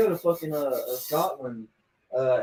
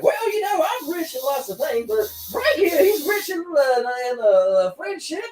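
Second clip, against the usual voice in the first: put-on high-pitched, hammy Texan accent; Charlie's Texan tycoon; 13 semitones higher than usual. Fake tycoon boosting his friend to his date, hammy Texan accent. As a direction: play a phony Texan oil tycoon. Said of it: "dresses like a Texan oil tycoon".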